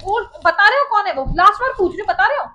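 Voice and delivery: loud last-warning questioning; angry demand. Real, not acted. Wife demands answer, last warning.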